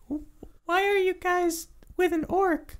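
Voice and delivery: high, halting little creature voice; wary kobold. Acted. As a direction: stammer it as a small, nervous kobold. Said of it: "he turns to you now and he just goes".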